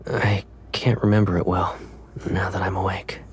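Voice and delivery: sleepy whisper; whispered Caleb. Acted. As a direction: whisper like he just woke. Held whispered character voice.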